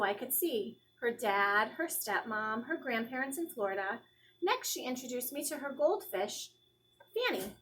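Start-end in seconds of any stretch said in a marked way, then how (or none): none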